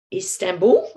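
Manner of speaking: normal talking voice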